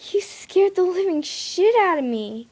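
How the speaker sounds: loud, high, shocked shout; startled yell. A narrator's reading. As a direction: yell it at him after the fright. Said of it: "I yelled".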